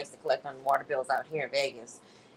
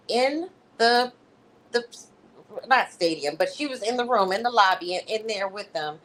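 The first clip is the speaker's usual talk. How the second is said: ordinary talk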